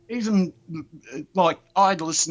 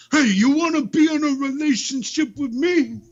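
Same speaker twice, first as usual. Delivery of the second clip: much higher, mock proposition; high put-on voice; 12 semitones higher, 4 dB louder than usual. Joker propositioning the co-host, high put-on voice. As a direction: pipe it up high, hammed-up.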